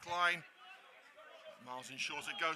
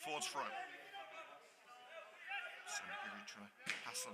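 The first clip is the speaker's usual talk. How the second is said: play-by-play; 5 dB quieter than usual. Acted. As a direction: follow the ball calmly, pass by pass. Live play-by-play of the match.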